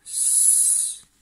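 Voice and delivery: "s" as a long unvoiced hiss; sustained "s", not the letter name; letter sound. Shown as a demonstration. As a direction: hold a pure hissing "s". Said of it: "il va chanter s".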